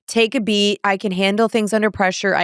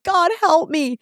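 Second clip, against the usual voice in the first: retold desperate plea; 8 semitones higher, slower than usual. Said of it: "I'm not even kidding".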